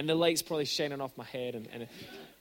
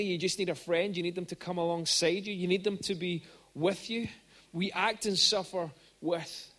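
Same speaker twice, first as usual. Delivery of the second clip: normal talking voice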